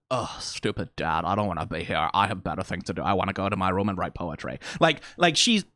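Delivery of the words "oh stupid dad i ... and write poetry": put-on sullen teenager, low and soft; mock an eye-rolling teen's moping; Luna. Teenager complaining about dad, low sulky mock voice. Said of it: "Luna is the teenager".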